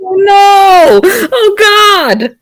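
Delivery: high, loud cries of dismay; appalled wailing. Real, not acted. Reacting to a cringeworthy line, appalled wailing. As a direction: wail in comic horror at what was read.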